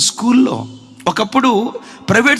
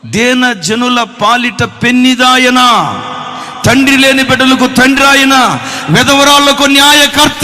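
thundering pulpit declaration; proclaiming scripture; 4 semitones higher, 6 dB louder than usual. Acted. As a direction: declare God's promises with full force.

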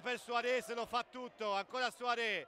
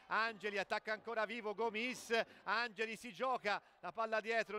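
normal talking voice

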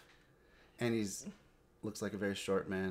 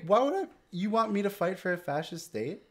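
much higher, louder mock outrage; mock indignant; 11 semitones higher, 6 dB louder than usual. Real, not acted. Host protesting the fascist commander role, mock indignant.

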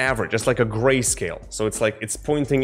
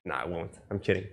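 much softer, lower throwaway retraction; joking aside; 6 semitones lower, 11 dB quieter, faster than usual. Real, not acted. Tutor taking back a joke about grading students, quiet aside. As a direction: drop the voice right down, as an aside.

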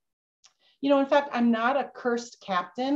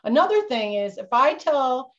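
usual speaking voice

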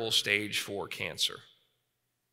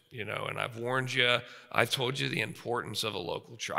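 softer parent letting go; quiet parental farewell; parent's farewell; 4 semitones lower, 5 dB quieter than usual. Retold or imitated. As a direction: gently hand your child over. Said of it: "they basically say".

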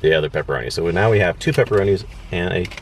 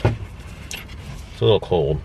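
talking between bites; chewing while talking; 4 dB quieter, much slower than usual. Real, not acted. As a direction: mouth partly full. Seen 1: Left man bit pizza at -3s, jaw chewing while speaking.

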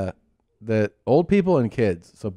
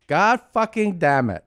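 put-on slow, higher, louder kid voice; toddler copying curse; 8 semitones higher, 3 dB louder than usual. Imitating the toddler repeating dad's curse, slower and higher. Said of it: "he'll go".

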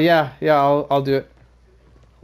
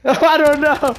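laughing; 9 semitones higher, 4 dB louder, much slower than usual. Real, not acted. Still laughing after the fight.

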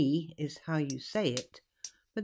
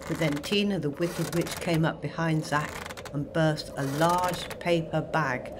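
ordinary delivery, nothing marked

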